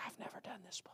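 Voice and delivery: hushed, whispered imitation of a freshman; mock whisper. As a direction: whisper it like a nervous first-timer. Voices a nervous freshman out soul winning.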